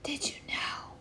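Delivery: a soft, secretive whisper. Acted. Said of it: "whispering now".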